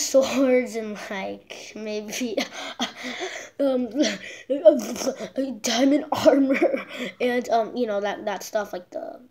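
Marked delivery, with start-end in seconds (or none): 2.4-3.5 breathy stifled giggle; giggling. Real, not acted. Laugh heard. 3.6-7.5 slow words through laughter; laughing. Real, not acted.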